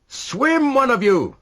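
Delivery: high, loud order; yelling. A narrator's reading. Rashleigh yelling at the men to swim, loud. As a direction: bark the command at the men. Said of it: "yelled Rashleigh".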